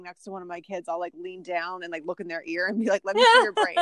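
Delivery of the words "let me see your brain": put-on high, loud sing-out to a child; playful teasing. Mom looking in her kid's ear, high playful tease. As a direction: pitch it way up, playfully nosy.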